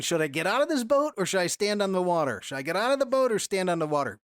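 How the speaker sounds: fast wavering inner debate; rapid back and forth. Retold or imitated. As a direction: rush through the dithering choice. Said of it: "I'm shifting between".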